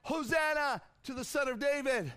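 loud, high Hosanna cry; children's shout. A narrator's reading. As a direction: call it out like excited children. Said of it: "the children shouting in the temple courts".

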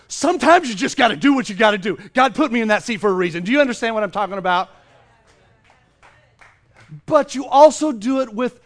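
very loud, impassioned outburst; full-force preaching. Real, not acted. Preacher justifying speaking up from his God-given seat, loud and forceful. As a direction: belt it out to the whole room.

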